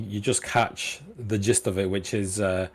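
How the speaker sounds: ordinary talk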